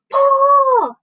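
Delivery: high, loud one-word command; shouting. A narrator's reading. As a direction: yell the signal to pull. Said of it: "shouted James".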